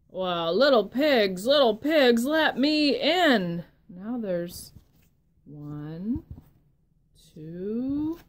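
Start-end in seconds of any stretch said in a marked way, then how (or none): 0.1-3.6 wolf voice. Acted. Wolf calling loudly to the pig at the door. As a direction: call out like the hungry wolf at the door.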